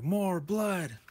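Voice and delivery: hammed-up villain calling for blood; mock bloodthirsty villain. Voices villain demanding more blood.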